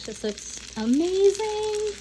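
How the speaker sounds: high, slow, delighted gush; excited gushing. Real, not acted. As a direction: stretch out amazing with delight. Gushing over finished dish.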